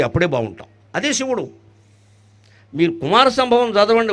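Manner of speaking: ordinary delivery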